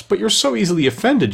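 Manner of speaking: high-pitched plea; pleading. A narrator's reading. Alice reasons with offended mouse, pleading. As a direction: plead in a lifted voice. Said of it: "pleaded poor Alice".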